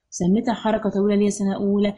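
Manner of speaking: normal talking voice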